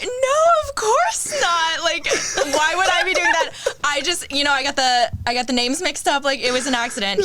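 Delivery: high-pitched, panicky excuse-making; acting out flustered backpedaling; flustered denial. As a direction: protest innocence in a high voice. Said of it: "I was so flustered".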